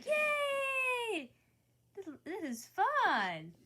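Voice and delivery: high, drawn-out happy squeal; gleeful cheer. Acted. Voicing a drawn guy enjoying the show, gleeful. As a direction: cheer in a high character voice, stretching it out.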